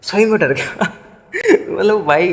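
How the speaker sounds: laughing through the words. Real, not acted. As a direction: chuckle while admitting the fright. (हँसते हुए)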